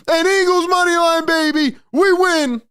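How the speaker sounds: high, thrilled victory cheer; excited cheer. Real, not acted. Fan crowing to viewers about winning bets, excited. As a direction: crow it out, money line won.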